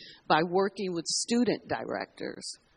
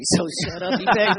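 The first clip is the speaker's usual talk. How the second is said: loud teasing brush-off, laughing; sassy mock scolding, half laughing; playful sass; 7 dB louder, faster than usual. Joking brush-off after Tony remark.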